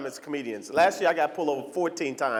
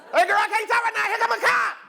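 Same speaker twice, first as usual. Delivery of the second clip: high phone voice. Retold or imitated. Talking to phone on the floor.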